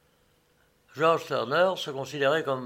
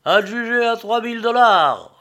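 high, loud closing call; auctioneer announcing sale; 8 semitones higher, 10 dB louder than usual. A narrator's reading. Agent declares the ticket sold, loud auctioneer announcement. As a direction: proclaim it sold to the whole hall. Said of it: "dit l'agent".

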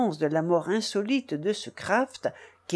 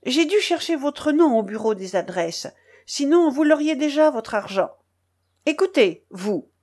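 put-on mildly furious young woman, higher voice; indignant confrontation; 6 semitones higher than usual. A narrator's reading. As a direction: demand to be heard, with a touch of fury.

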